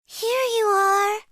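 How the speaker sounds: high, small cub voice; a young bear offering a present; little bear voice. Acted. Little bear handing it over, high and small.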